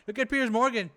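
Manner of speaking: normal talking voice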